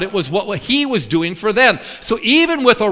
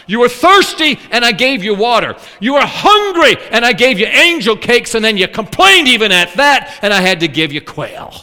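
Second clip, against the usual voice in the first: loud, building preacher cadence; rising preaching emphasis; 3 semitones higher, 6 dB louder than usual. Real, not acted. Preacher reminds congregation of God's gifts, loudly. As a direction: hammer each line home.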